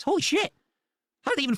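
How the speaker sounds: shocked exclamation that cracks up high; real astonished squeak; voice cracking. Real, not acted. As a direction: let the voice break upward in disbelief. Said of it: "Why is your voice cracking like that".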